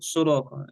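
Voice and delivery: "صراطا" said as surata; mistaken damma. Retold or imitated. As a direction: start "صراطا" with a rounded u. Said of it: "مكسورة أو مضمومة".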